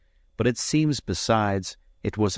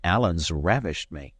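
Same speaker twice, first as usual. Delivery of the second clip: ordinary delivery, nothing marked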